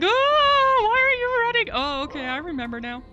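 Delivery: high, loud fake panic, then a calm realisation; mock startled squeal. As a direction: act out being startled by sprinting zombies. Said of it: "and I'm like".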